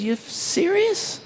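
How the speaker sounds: high, slow incredulous question; surprised disbelief. Retold or imitated. Preacher retelling the stunned passenger, high amazed disbelief. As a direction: let the disbelief stretch the words.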